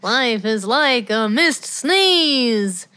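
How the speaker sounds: drawn-out slow parody delivery; slow parody line. Retold or imitated. Riffing with a co-host, slow parody delivery. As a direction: stretch each word slowly, deadpan.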